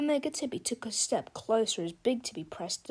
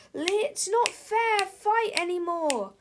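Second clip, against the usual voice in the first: crying out; 8 semitones higher, 3 dB louder, slower than usual. Acted. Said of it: "cried Harold".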